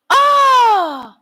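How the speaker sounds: very high, loud delighted Oh; excited locals. Retold or imitated. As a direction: shriek the Oh with joy. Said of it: "they say".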